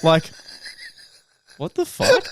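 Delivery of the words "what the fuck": shocked retort. Retold or imitated. Startled reaction retold.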